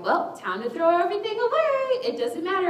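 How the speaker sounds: high, sing-song surrender; mock breezy giving up. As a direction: toss it all away theatrically. Acted flippant giving up.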